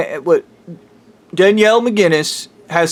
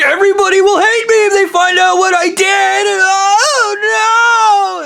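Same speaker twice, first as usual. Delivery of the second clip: high, crying mock shout of panic; crying panic impression. Mocking a character who fled, high crying shout. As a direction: wail it like a scared coward. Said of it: "he was like".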